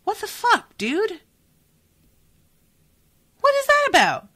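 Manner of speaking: high, loud outburst of real disbelief; outraged disbelief. Real, not acted. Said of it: "I'm so mad".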